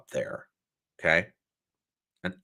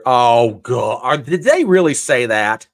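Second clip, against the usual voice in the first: exasperated disbelief; 3 semitones higher, 11 dB louder, slower than usual. Real, not acted. Reacting to Texas update.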